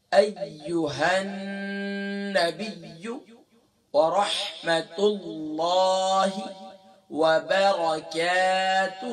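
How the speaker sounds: slow, drawn-out chanted recitation. Shown as a demonstration. Teacher reciting the tashahhud for students, slow and chanted. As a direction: intone each phrase slowly like a prayer.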